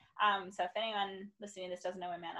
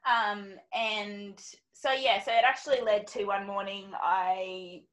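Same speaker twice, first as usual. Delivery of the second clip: normal talking voice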